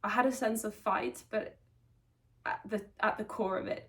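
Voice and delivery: ordinary talk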